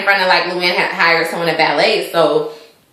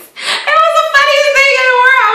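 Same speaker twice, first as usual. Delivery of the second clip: high, squealing laugh through the words; really cracking up while talking; helpless amusement. Real, not acted. Recapper finds the calm-down scene hilarious, laughing hard.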